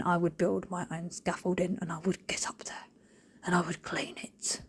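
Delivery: low, hushed, resolute whisper; determined whisper. Real, not acted. Swearing she would scaffold and clean the neighbour's wall, whispered. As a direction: whisper it like a secret vow.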